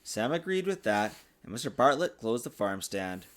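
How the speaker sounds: plain reading voice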